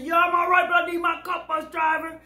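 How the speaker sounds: high shouted insistence; retold demand. Woman insisting on her cup, mock shout.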